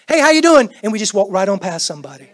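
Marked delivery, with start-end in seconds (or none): 0.1-0.7 loud, high throwaway greeting; breezy passing hello. Retold or imitated. Preacher imitating a careless passing hello, loud and breezy. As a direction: toss it off while walking past. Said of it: "we're like".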